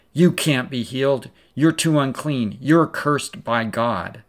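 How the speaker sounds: loud, harsh rebuke, last words slowed; harsh condemnation. Acted. Townspeople condemning the unclean woman, loud and harsh. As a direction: throw the words at her like a verdict.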